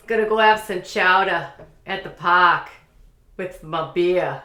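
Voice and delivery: hammed-up Boston accent, dropped Rs. Shown as a demonstration. Another go at the phrases, Boston accent. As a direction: lay on the Boston, slowing at the end. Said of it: "Can I do a Boston accent?".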